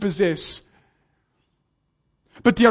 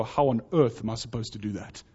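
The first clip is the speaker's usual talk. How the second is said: quiet incredulous aside; 10 semitones lower, 10 dB quieter, faster than usual. Retold or imitated. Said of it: "How many of you would just go".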